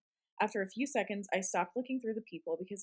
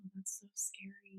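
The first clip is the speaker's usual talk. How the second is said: hushed scared whisper; 12 dB quieter than usual. Real, not acted. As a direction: breathe it out in a whisper. Reacting to ghost story.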